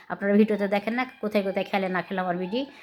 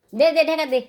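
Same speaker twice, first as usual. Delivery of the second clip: higher, louder call to someone nearby; 6 semitones higher, 4 dB louder than usual. Real, not acted. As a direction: call across the room to get it covered. Calls to someone off camera.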